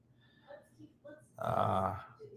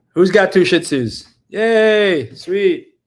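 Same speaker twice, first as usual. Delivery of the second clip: loud, high "Yay!"; excited cheer; 5 semitones higher, 11 dB louder, much slower than usual. Real, not acted. Streamer cheering a viewer with two Shih Tzus, loud and high. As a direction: cheer loudly for a fellow Shih Tzu owner.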